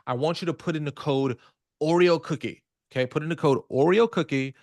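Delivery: loud, upbeat radio ad read. Shown as a demonstration. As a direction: pitch it like a radio spot. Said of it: "I'm gonna just say it like a radio ad".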